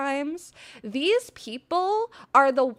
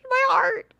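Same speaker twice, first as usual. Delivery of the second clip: tearful; 11 semitones higher, 5 dB louder than usual. Real, not acted. Moved to tears by fan message.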